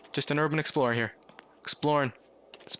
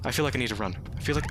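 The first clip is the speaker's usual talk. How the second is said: ordinary delivery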